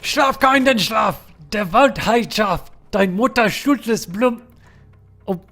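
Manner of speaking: slow, high, loud German lullaby; shouted German. Acted. Streamer reciting German lullaby for chat, loud shout. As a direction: bellow a German lullaby, word by word. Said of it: "the authentic German".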